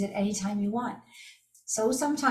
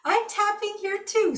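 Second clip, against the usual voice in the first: bright, much higher lady's voice, asking gently; friendly stranger; 11 semitones higher, 4 dB louder than usual. Retold or imitated. Stranger in the bathroom asking if she's okay, high and friendly. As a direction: chirp it up high like a curious lady. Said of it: "She goes".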